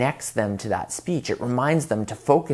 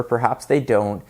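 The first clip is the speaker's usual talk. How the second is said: ordinary delivery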